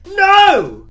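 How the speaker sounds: loud, high drawn-out "no"; emphatic refusal. Acted. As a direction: yell a refusal that swoops down from up high. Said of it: "Johnny says".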